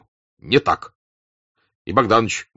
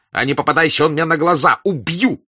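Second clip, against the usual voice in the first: high, raised, threatening cry; fierce threat climbing in pitch; bloodthirsty shout; 8 semitones higher, 5 dB louder than usual. A narrator's reading. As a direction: yell it as a savage threat. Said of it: "кровожадно прокричал Денисов".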